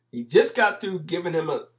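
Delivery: normal talking voice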